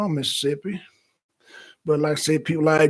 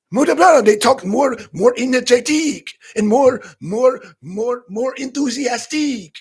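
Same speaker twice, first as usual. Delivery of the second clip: hammed-up French accent, higher and louder; mock French accent; 7 semitones higher, 7 dB louder than usual. Shown as a demonstration. Streamer imitating how people from France talk, hammed-up energetic French accent. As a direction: play an over-energetic, enthusiastic Frenchman with a thick French accent. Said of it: "somebody from France. They talk like this".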